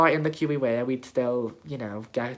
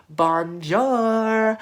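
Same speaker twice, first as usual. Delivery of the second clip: high, loud, drawn-out bonjour; Aquaria's entrance greeting; 8 semitones higher, 7 dB louder than usual. Retold or imitated. Fan quoting the drag queen's entrance to her, loud showy greeting. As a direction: belt out a long, showy bonjour. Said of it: "I quoted her entrance and was like".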